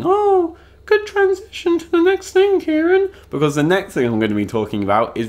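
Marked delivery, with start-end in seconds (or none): 0.0-3.1 very high falsetto heckler; mock praise voice. Praising his own transition to the next thing, mock falsetto. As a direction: squeak it out far above your usual pitch.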